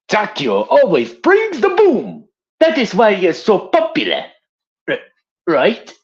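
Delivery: a proud Japanese soldier bragging; Takeo. Acted. Voicing Takeo as his next voice line, proud Japanese accent. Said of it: "the next voice is gonna be a Imperial Japanese soldier named Takeo Mizaki".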